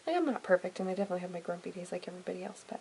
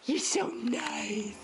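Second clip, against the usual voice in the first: slow cooing; 8 semitones higher, much slower than usual. Real, not acted. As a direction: draw the words out tenderly. Cooing slowly to baby.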